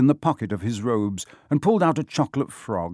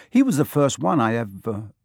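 usual speaking voice

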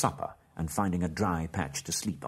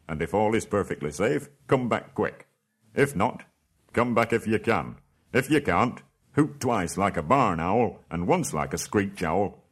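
louder, urgent orders; commanding dwarf king; 2 semitones higher, 6 dB louder than usual. Acted. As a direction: press the instructions on him. Thorin gives Bilbo orders.

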